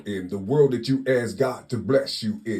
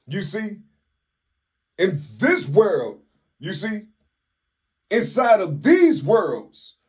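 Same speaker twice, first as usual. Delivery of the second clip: much higher and louder, fervent exhortation; preaching at full force; 9 semitones higher, 3 dB louder than usual. Real, not acted. Speaker urges listeners to use their whole mind, preaching at full force. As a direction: lift it into a fiery sermon peak. Preaching climax, high and loud.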